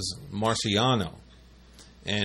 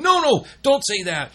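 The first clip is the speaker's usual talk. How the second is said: much higher, louder urgent objection; alarmed protest; 5 dB louder than usual. Retold or imitated. Stopping coworker's rude remark in alarm, higher and louder. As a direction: cut them off fast, shocked.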